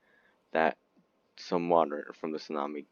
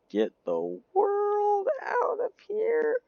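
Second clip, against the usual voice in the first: squeak; slower than usual. Acted. Ship fleeing tsunami, squeaky voice.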